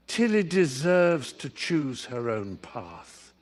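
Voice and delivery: plain reading voice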